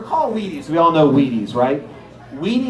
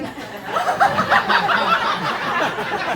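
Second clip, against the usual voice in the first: squealing laugh, very high; high burst of laughter. Real, not acted. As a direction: let the laugh shoot up.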